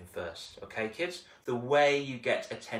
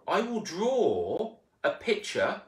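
usual speaking voice

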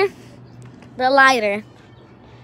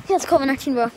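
ordinary talk, nothing marked

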